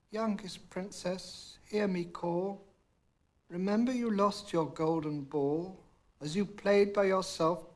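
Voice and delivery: a creature pleading in verse at the door; frog calling out. A narrator's reading. Frog at the door reminding the princess, lightly voiced plea. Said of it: "a voice cried out".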